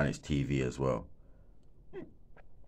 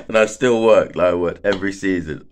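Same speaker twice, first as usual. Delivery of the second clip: emphatic; 5 semitones higher, 11 dB louder than usual. Real, not acted. Defending his dedication.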